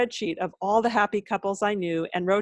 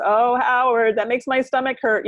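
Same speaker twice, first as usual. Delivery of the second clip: higher, louder mock complaint; mock pained comedian; 5 semitones higher, 4 dB louder than usual. Comedian dodging Howard Stern's question, higher mock whine. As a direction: whine it as a joke dodge. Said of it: "said something, goes".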